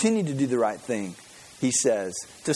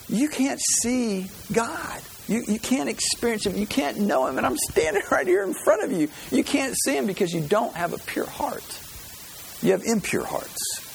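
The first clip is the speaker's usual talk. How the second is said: Jesus rebuking; 7 semitones higher than usual. Retold or imitated. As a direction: plead with them as Jesus would. Preacher voicing Jesus rebuking crowd.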